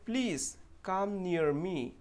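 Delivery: very slow, stretched sample sentence; slow example read. Shown as a demonstration. Teacher slowly reading a sample sentence aloud. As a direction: stretch near into ee-uh, unhurried. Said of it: "तो दूसरा sentence है".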